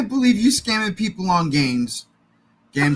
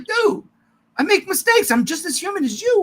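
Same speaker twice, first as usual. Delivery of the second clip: higher-pitched, earnest retold plea; Superman; 9 semitones higher than usual. Superman confiding in Lex at the movie's end, raised voice. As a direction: plead it lightly in a raised voice. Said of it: "He's like".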